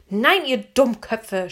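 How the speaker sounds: loud, slow, indignant yell; girl yelling at the guards; Anne yelling. A narrator's reading. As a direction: shout them down. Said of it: "schrie Anne".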